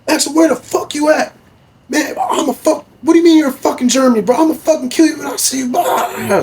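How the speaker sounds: high, loud, fast mock rage; imitated screaming. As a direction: scream it like a furious friend on FaceTime.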